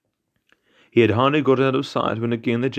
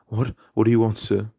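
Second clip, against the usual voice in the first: ordinary delivery, nothing marked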